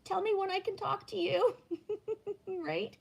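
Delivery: very high, sing-song mock-cheerful voice; squeaky overly chipper answer; high sweet reply. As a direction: play the bubbly morning person, way up high. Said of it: "it would be like".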